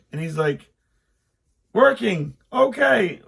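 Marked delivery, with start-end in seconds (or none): 1.7-3.2 high, loud cheerful mock reply; son shouting back. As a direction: call it back brightly over the engine.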